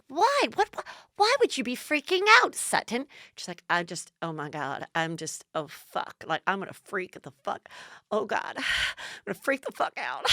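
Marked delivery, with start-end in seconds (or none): 0.1-3.1 high-pitched mock bafflement; put-on high, puzzled questioning; Dorit. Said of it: "And Dorit's like". 3.7-10.3 soft, sighing imitation of a meltdown; under-the-voice, hammed-up freak-out; hushed mock panic. Sutton's muttered freak-out, acted.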